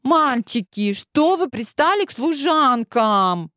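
put-on high, slow, pouty girl voice; capricious drawl. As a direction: whine it like a spoiled princess. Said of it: "капризно протянула Клементина".

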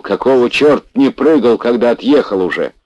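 furious yell. Acted. Fat man yells at Pashka after the crash, furious shout. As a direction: bawl him out, terrified and furious. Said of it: "заорал".

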